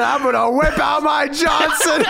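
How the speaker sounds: high, slow hammed-up shout; mock proud proclamation. Wrestler announcing himself, mock proud proclamation. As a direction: belt out the joke announcement.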